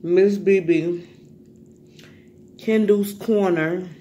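loud, slow name announcement; shout-out call. Real, not acted. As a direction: stretch each name out loudly. Calling out names in shout-outs.

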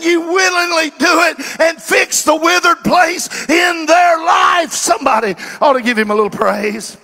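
high, loud revival-preacher cry; full-force preaching. Acted. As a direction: preach it out to the back row.

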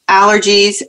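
ordinary delivery

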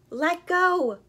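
loud, high shout; urgent drawn-out yell. A narrator's reading. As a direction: holler it across the distance. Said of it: "he yelled".

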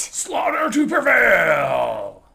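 slow, loud band name shout; announcer shout. Acted.

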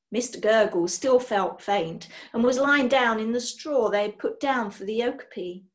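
usual speaking voice

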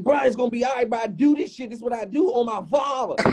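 confident retold boast; high imitated Craig. Craig quoted defending his cooking.

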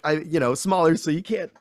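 normal talking voice

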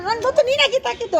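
loud, high insistent accusation; demonstrated stubbornness. Shown as a demonstration. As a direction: dig in and accuse loudly. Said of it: "ಹಠ ಮಾಡು".

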